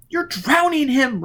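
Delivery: high, loud and slow horrified outcry; alarmed cry. Acted. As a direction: cry out to stop him. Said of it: "Robin cried".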